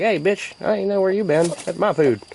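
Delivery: a lightly voiced, sassy little chicken guarding its food; voicing a chicken. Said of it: "this little dude's like".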